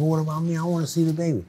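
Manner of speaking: high, pleading; retold plea. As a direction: beg her, voice up high. Pleading with leaving partner.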